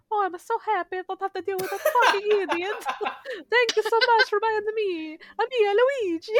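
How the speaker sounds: high, loud, laughing relief; gleeful Luigi. Retold or imitated. Luigi thanking the buyer, high giddy glee. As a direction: gloat with joy at being free. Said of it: "he's like".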